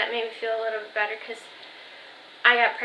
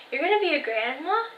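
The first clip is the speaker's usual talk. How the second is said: higher, rising retold question; mock-incredulous, pitch climbing sharply; surprised realization; 4 semitones higher than usual. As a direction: imitate grandma's astonishment, swooping up at the end. Grandma's dawning realization, retold.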